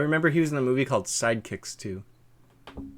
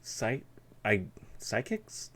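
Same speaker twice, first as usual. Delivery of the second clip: ordinary talk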